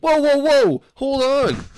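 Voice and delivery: panicked whoa, hold on; alarmed protest. Retold or imitated. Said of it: "the bus driver was like".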